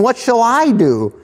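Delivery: high anxious farmer voice; fretting rich farmer. Acted. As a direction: voice the rich man wringing his hands. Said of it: "He thought within himself, saying".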